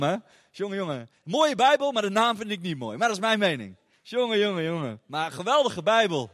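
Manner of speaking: ordinary talk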